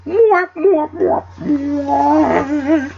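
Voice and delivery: high hammed-up sobbing mwah; fake-cry in falsetto; mock wailing. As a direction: mwah mwah as cartoon weeping. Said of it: "Oh no, my shoes".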